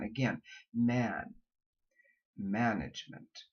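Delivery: Management with a low a before the N; modeling the low a. Shown as a demonstration. Coach demonstrates the a before a nasal. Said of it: "the sound of the nasalized N".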